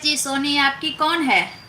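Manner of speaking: normal talking voice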